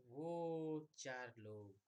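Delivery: very slow, stretching each word; drawn-out phrase. Shown as a demonstration. Teacher to students, slowly stretching out the four electrons. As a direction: draw the words out as you point.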